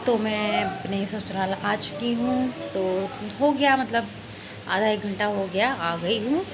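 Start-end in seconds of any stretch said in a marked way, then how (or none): none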